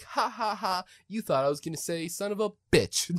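high laughing cartoon taunt; mocking cartoon laugh. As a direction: cackle it like a cartoon pig. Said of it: "He was like".

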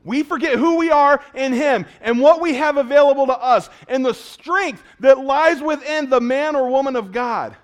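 loud, high, ringing preacher's cry; preaching at full force. Acted. Pastor preaching loud and high to the congregation. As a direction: lift it to the back row.

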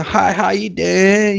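slow lightly drawled Southern hello; a hint of drunk Alabama twang; drunk Southern accent. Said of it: "they just like".

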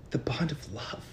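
soft mock-sentimental whisper. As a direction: whisper it like a sappy secret.

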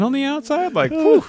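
high, loud excited gush; mock-swooning over his looks; gushing whew. Real, not acted. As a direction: let the admiration burst out.

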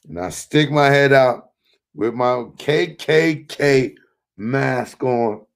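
ordinary delivery

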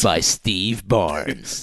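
very slow, low trailer credit; movie trailer announcer. Pretend. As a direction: stretch out the credit. Trailer voice bit.

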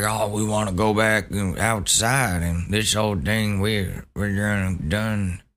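whiny mock protester. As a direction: sneer it like a complaining protester. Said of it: "All these people that are mad about, like".

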